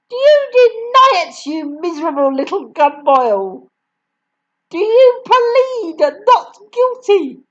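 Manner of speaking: very high, loud accusing questions; furious interrogation. A narrator's reading. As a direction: grill him like a cruel judge. Headmistress interrogates the boy.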